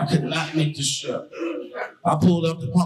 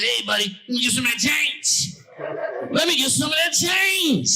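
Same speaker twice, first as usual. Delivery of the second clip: pushy beggar; 11 semitones higher than usual. Retold or imitated. Homeless man at the gas pump begs for change, rising to a shout. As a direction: beg harder each time. Homeless guy begging, insistent.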